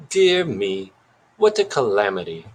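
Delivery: mock-British dismay; weird British accent. A narrator's reading. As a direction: lament it like a stagey British detective. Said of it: "still in that weird accent".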